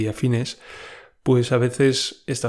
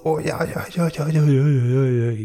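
high, louder string of whimpers; whimpering ays; 3 semitones higher, 4 dB louder than usual. Real, not acted. After the spider story, whimpering in fear. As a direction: whimper in leftover fright. Reliving fright.

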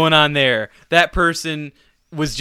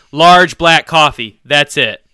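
loud, slow, deliberate order; loud and simple; 10 dB louder, slower than usual. Retold or imitated. Ordering at the drive-thru speaker, loud and slow. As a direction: spell it out loudly for the speaker box. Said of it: "as loud and as simple as possible".

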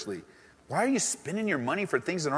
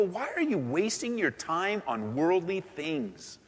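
impassioned appeal; 8 semitones higher than usual. Pretend. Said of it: "you can go".

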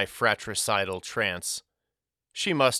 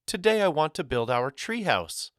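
put-on high, insistent girl's demand; countering; 7 semitones higher than usual. A narrator's reading. Girl pushing for the treehouse, countering. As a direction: insist on it in a raised pitch. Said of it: "she countered".

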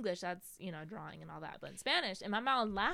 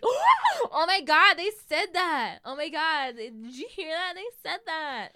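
very loud, high, slow shock; hammed-up astonishment, loud and high; shocked gasp; 8 semitones higher, 13 dB louder, slower than usual. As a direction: gasp out in disbelief.

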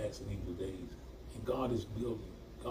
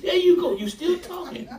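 excited shout; 9 dB louder than usual. Real, not acted. Excited call back to audience.

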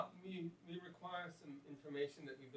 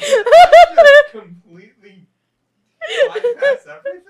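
laughing disbelief; 32 dB louder, much slower than usual. Real, not acted. Reacting to skipped quest.

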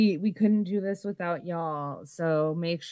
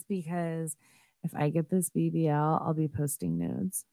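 ordinary delivery

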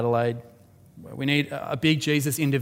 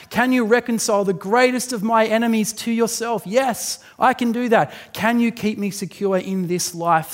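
higher, louder, impassioned sermon climax; preaching at full force; 7 semitones higher, 4 dB louder than usual. Acted. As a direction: build the sermon to a ringing peak.

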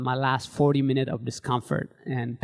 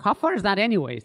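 lightly voiced curious non-runner, higher; higher-pitched retold question; naive questioner; 6 semitones higher, 4 dB louder than usual. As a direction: imitate a mildly puzzled colleague asking.

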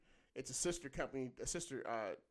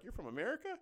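higher-pitched mock amazement, rising; amazed question; 7 semitones higher than usual. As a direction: imitate an amazed local asking. Acted foreigners amazed at Americans.

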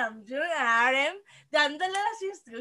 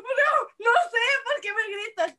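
high-pitched protesting reply; acted-out exasperated comeback; retold protest; 7 semitones higher, 4 dB louder than usual. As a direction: answer back high, as if being yelled at. Acted reply to being yelled at.

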